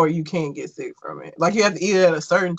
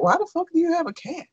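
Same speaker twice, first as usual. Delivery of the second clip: higher-pitched, quick incredulous question; baffled mock question; 10 semitones higher, faster than usual. Questioning a cat owner, baffled. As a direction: ask it in exaggerated bafflement.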